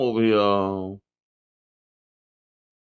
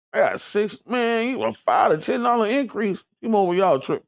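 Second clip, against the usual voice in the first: pitched up in outraged disbelief; indignant disbelief; 7 semitones higher than usual. Real, not acted. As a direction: react like the bill just hit you. Reacting to price increase.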